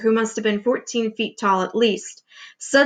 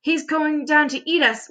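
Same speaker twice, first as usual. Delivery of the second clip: put-on higher, mildly wailing old grasshopper; a touch of a frightened wail; 5 semitones higher than usual. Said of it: "wailed the old green grasshopper".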